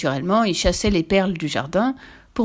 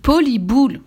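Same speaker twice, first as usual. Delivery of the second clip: loud, raised correcting shout; father shouting; 6 semitones higher, 6 dB louder than usual. A narrator's reading. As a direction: shout the correction over the child. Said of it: "cria son, plus fort son père". (cri du père, plus fort)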